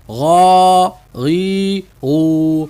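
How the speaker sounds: غا غی غو stretched slowly; drawn-out drill. Shown as a demonstration. Teacher drilling غ syllables slowly. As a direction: drag out each غا غی غو. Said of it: "تو غا غی غو".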